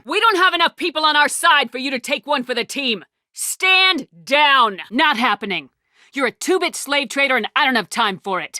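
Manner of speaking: high, loud, forceful orders; angry commanding shout; forceful commands. Acted. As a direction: bark it at them. Heated commands and confrontation.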